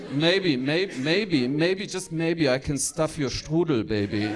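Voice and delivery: lightly voiced German lover, hammed-up; mock seduction in a German accent; German dirty talk. Gunther woos before sexy time, comically German-accented. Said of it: "the dirty talk".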